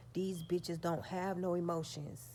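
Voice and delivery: ordinary talk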